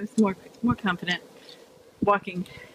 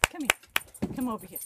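high, coaxing pet talk; calling the dog; 3 semitones higher than usual. Real, not acted. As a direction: coax the dog to come over.

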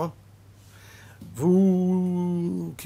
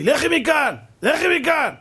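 accusing wife quoting; 8 semitones higher, slower than usual. Retold or imitated. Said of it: "אמרת לי".

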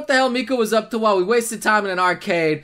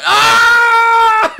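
long, high, loud scream; shocked scream; 9 semitones higher, 9 dB louder than usual. Real, not acted. Shocked by scene in game.

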